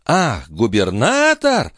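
drawn-out, high, mocking outrage; threatening exclamation. A narrator's reading. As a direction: stretch the words slowly in indignation. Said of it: "воскликнула графиня".

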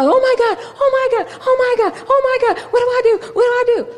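high, loud, frantic repeated cries; mock panic. Wife mocking her own panic at husband's attack, high frantic cries. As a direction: play the wife who loses it when her husband collapses. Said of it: "I don't panic".

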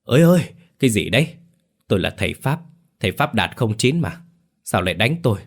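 yelping high and loud in panic; high frantic cry of a beaten man; shrill squawk. Acted. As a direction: squeal out in protest while being beaten. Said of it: "Thầy kêu lên choe choé".

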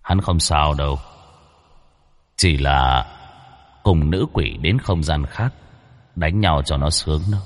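low, cold and weighty figure of authority; cold, heavy voice. Acted. Unseen being speaking up from behind, cold and heavy. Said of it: "âm thanh lạnh lẽo nhưng nặng nề".

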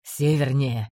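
low, curt commander; terse low answer. A narrator's reading.

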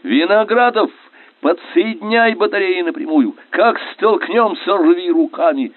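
high, forceful commands; shouted orders. A narrator's reading. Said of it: "крикнул лейтенант".

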